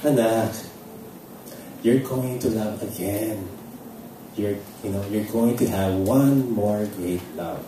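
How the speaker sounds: quiet tender promise from God; gentle reassurance. Retold or imitated. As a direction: comfort her softly. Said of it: "spoke to me and said".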